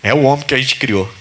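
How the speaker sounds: lightly voiced weary Jesus; Jesus answering. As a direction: shrug it off as Jesus to the Father. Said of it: "Jesus falando pro pai assim".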